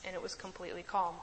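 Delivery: ordinary talk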